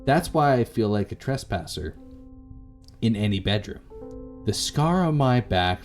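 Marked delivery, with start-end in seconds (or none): none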